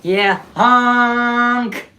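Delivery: loud horn-like honk. Retold or imitated. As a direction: blare it like a ship horn. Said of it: "that's literally how loud a Sip song is".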